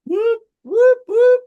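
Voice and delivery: loud high whoop whoop; excited whooping. Real, not acted. Announcing special guest star.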